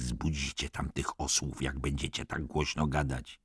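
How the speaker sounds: ściszone, gniewne upomnienie; gniewny syk. A narrator's reading. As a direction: uciszaj ich cicho i ze złością. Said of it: "syknął Scanlon i spojrzał gniewnie". (przyciszony głos pełen irytacji)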